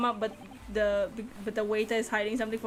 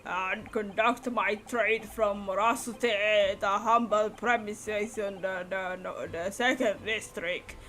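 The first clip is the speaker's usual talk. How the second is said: put-on slow, lowered old-man drawl; slow old collector; much slower than usual. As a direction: ramble slowly like an old gent.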